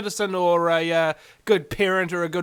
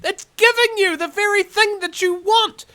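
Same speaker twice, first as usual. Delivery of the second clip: high, loud exasperated retort; exasperated shout; 13 semitones higher, 7 dB louder than usual. Real, not acted. Confronting socialist protesters, loud and high. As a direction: hurl the question back at them.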